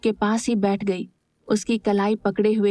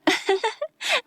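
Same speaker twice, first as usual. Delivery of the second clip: put-on high girlish giggle; light laugh; 11 semitones higher than usual. A narrator's reading. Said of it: "Meera हंसकर बोली". (ऊँची हल्की हंसी)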